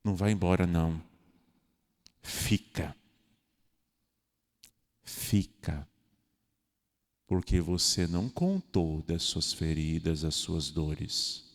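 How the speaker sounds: soft, low urging to stay; pleading invitation. Pretend. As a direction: gently beg him to stay. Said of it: "fé turva". (convite suplicante, baixo e suave)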